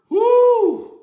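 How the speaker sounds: high, loud joyful whoop; excited whoop. Real, not acted. To viewers after his testimony, a loud excited whoop. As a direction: let out a big woo.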